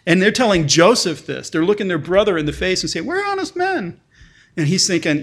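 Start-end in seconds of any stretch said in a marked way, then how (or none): 3.0-4.0 sarcastic, much higher, put-on innocent voice; mock sincerity. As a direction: parrot their claim with heavy irony. Mocking the brothers' lie.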